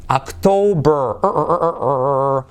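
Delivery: "October" stretched, then the final "er" drilled; erre inglesa final. Shown as a demonstration. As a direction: draw out "October" into repeated English "er" sounds. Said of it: "para pronunciar la letra clave R". («October» alargado, repitiendo la «er» inglesa final)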